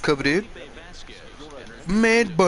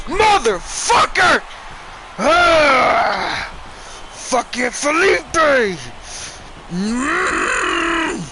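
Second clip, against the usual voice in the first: loud, higher, slow roar of rage; furious shout; 10 semitones higher, 5 dB louder, much slower than usual. Real, not acted. Gamer losing the game, screaming at Felipe. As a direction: bellow it at the screen.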